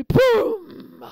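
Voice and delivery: sudden high shouted boom; loud boom. A narrator's reading. Narrator marking the rabbit bumping into the fox, loud. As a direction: shout the crash.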